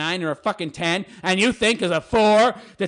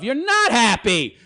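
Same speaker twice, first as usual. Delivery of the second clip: normal talking voice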